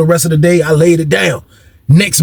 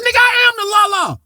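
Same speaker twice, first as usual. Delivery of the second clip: defiant comeback. Acted. Inmate imagines talking back to Mister Brown, mock defiant. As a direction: throw it back in a squeaky high protest.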